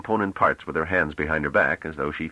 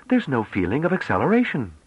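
put-on bright, eager girl's voice; young woman voice; 11 semitones higher, 3 dB louder than usual. A narrator's reading. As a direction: voice her as an excited young woman.

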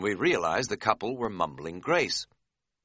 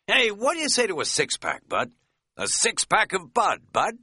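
high, loud, punning party patter; milk the pun on Bud with gusto; jokey; 12 semitones higher, 5 dB louder than usual. Acted. Shopper nudging his friend with a beer joke, boisterously.

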